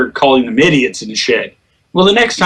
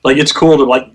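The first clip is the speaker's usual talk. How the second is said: normal talking voice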